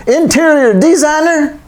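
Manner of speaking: high, drawn-out mock Texan; hammed-up Texan accent; stupid Texan accent. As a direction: stretch it out in a silly high Texan voice. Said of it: "I'm gonna do a stupid Texan accent".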